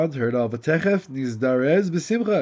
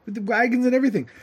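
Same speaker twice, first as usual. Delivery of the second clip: excited husband; 10 semitones higher than usual. Acted. Simpleton tells his wife the king wants him, high and excited. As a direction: rush in bursting with news.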